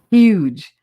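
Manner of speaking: HUGE with a pushed, breathy H at the front; H pronounced. Shown as a demonstration. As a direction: say "huge" with a clear H. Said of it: "I pronounced the H in huge".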